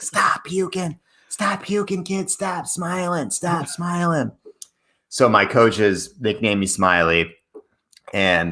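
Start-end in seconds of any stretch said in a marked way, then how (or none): 0.0-4.3 high Boston coach call, stretched out; Boston accent. Retold or imitated. Coach nagging smiling runner, high drawn-out Boston accent. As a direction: imitate a Boston coach calling from afar. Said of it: "the Boston accent".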